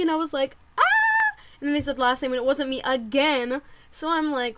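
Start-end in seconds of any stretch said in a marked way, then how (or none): 0.8-1.4 very high, sustained squeal; imitated fangirl shriek; excited squeal. Hearing her name called a second time, excited high squeal.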